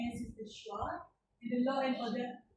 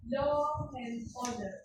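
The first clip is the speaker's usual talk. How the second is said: ordinary talk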